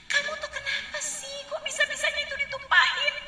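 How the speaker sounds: high, sharp scolding mother. Retold or imitated. Mother standing over her child, scolding high-pitched. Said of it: "ibu lagi marahin anaknya".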